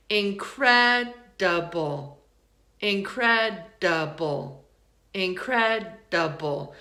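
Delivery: slow "incredible" split as in-cred, duh-bull; third-syllable schwa. Shown as a demonstration. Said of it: "It sounds like uh".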